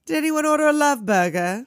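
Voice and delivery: high, loud mock crooner line; playful quote voice. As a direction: ham up a cheesy rocker line. Quoting the movie band singer.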